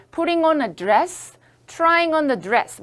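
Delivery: putting on a dress, trying on the dress를 천천히 또박또박; slow, clear model sentences; slow model reading. Shown as a demonstration. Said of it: "입는 중이야". (선생님이 천천히 읽어 주는 예문)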